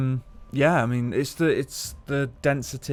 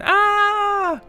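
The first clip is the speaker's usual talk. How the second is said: high-pitched mock scream, held on one note; imitated audience scream; 6 dB louder than usual. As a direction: scream like a panicked crowd member. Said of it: "audience members started screaming".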